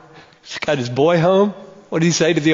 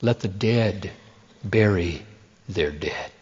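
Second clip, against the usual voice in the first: much lower and softer, slow and grave; a hushed, weighty pronouncement; Jesus speaking low and soft to the hesitant follower; solemn Jesus; 10 semitones lower, 7 dB quieter, slower than usual. Retold or imitated. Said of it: "And Jesus says".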